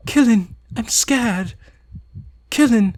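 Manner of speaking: put-on high, fragile girl's voice; small and scared, barely holding on; terrified girl confessing; frightened Yuri.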